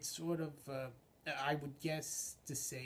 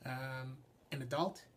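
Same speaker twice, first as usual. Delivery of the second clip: ordinary delivery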